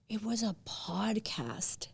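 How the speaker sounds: whispered, slow and hushed; hushed unvoiced whisper. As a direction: breathe it out in disbelief. Whispered aside to herself.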